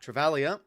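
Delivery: "Travaglia" with the g dropped, lifted at the end; silent G. Shown as a demonstration. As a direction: sound out "Travaglia" as tra-VAL-ya. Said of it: "there's no G in the, uh, or silent G".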